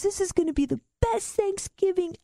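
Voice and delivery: high, strained mock-hysterical voice; hammed-up helpless glee; imitating hysterical laughter. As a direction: squeal it as if crying with laughter. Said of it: "He starts laughing so hard he's crying".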